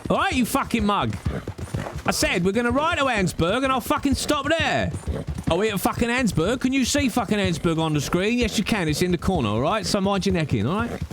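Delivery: high, exasperated Cockney rant. Pretend. Streamer telling chat to wait till Annesburg, high irate Cockney. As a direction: tell them off in irritated Cockney. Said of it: "You've heard my Cockney".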